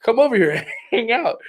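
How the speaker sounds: high, sing-song come over here; luring the thief in, falsely friendly; fake friendly lure. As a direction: bait him with fake welcome. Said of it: "and he goes".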